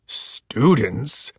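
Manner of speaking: low, rising puzzled monster question. Acted. Monster learning the boys are her students, puzzled. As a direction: ask it, caught off guard. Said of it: "asked the monster".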